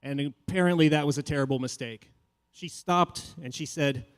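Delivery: ordinary delivery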